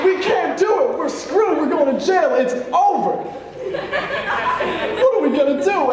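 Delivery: high, frantic mock despair; panicked wailing. Kid sure he's going to jail, hammed-up panic. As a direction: cry out as if doomed. Acts out panic after hanging up.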